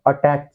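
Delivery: अटैक begun with a plain "uh", as in everyday Hindi; common Indian pronunciation. Shown as a demonstration. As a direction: open अटैक on a short uh. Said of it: "इसको बचपन से बोलते आ रहे थे". (अटैक की शुरुआत 'अ' से)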